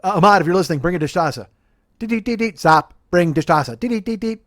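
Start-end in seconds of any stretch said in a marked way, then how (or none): 2.0-4.4 staccato beeps, higher pitch, then clipped telegram words; Morse code beeps. Pretend. Messaging a friend on a podcast through mock Morse code beeps. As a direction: tap it out like a telegraph. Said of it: "Morse code".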